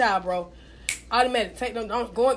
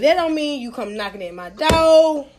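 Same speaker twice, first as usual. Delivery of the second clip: louder, punching each one time; emphatic; 3 semitones higher, 6 dB louder, slower than usual. Real, not acted. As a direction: lay down the law firmly. Laying down the rule.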